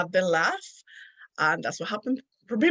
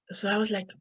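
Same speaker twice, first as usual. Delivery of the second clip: ordinary delivery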